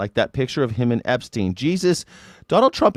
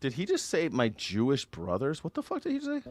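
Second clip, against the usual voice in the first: incredulous; 3 semitones higher, 9 dB quieter than usual. Real, not acted. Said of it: "disbelief at a news clip".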